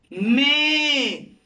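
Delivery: "Me" held long for learners; sílaba modelo. Shown as a demonstration. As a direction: stretch the vowel of "Me". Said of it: "me da como sílaba". ("Me" alargado, como modelo)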